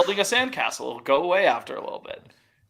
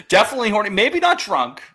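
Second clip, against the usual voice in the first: laughing, louder and fast, punch DEFINITELY; laughing emphasis; 5 semitones higher, 4 dB louder than usual. Real, not acted. Laughing back at his co-host's joke about rowdy kids. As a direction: blurt it out through a laugh.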